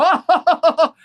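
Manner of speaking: high loud mock gasp of a scandalised crowd; mock shocked gasp. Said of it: "they go".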